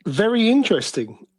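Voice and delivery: ordinary delivery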